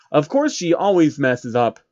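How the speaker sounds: usual speaking voice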